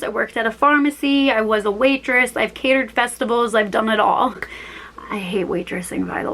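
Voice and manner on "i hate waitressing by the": hushed confession under her breath; whispered aside. Real, not acted. Whispered aside about hating waitressing.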